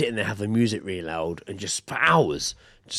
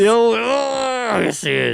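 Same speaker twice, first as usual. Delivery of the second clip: high loud panto shout; panto crowd callback; 13 semitones higher, 6 dB louder, much slower than usual. Pretend. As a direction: call it out to the stage.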